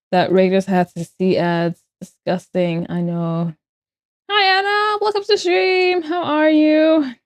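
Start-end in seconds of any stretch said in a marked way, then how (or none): 4.3-7.1 high sweet greeting. Real, not acted. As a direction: coo the hello up high. Greeting chat, much higher.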